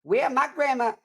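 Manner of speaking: high, hammed-up Southern grandma voice; overdone Southern accent. Trying out grandma's Southern accent, overdone. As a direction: overshoot the Southern grandma impression. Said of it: "that's a little more ethnic".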